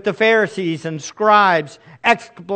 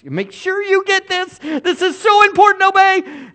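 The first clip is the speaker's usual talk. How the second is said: high, strained parental insistence; exasperated parent repeating the order; insistent parent; 12 semitones higher, 3 dB louder than usual. Acted. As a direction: drive it home, pitched way up. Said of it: "You'll say it, like, fourteen different times".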